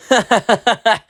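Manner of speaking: high, sneering cackle; a bully laughing at the nerd, high and mean; mocking bully laugh.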